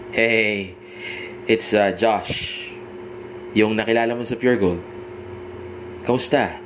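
easy, sure-of-himself hello; confident phone intro. Shown as a demonstration. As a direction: introduce yourself like you know she remembers. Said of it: "yun yung tamang tono". (kumpiyansang pagpapakilala sa telepono)

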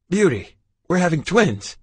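loud, high joyful cry; elated father telling his wife; overjoyed. Acted. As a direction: burst out with delight. Father overjoyed at twins news.

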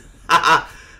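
loud, short triumphant laugh. Real, not acted. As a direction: burst out "ha ha".

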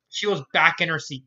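usual speaking voice

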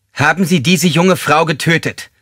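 high, intimidating interrogation. Acted. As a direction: put all the weight into the accusing question. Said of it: "Mit erhobener Stimme in forderndem, angsteinflößendem Ton".

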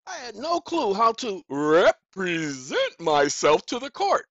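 "represent" stretched out slowly and loud; slow, loud weight on "represent"; emphatic drawn-out stress. Real, not acted. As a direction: drag out "represent", syllable by syllable. Emphatic show of his cluelessness.

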